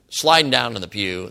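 normal talking voice

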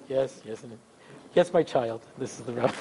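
hushed, very low rabbi voice; resume a soft, deep, solemn phone manner; quiet, low mock-pious rabbi; put-on rabbi voice. Shown as a demonstration. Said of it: "put on my rabbi voice".

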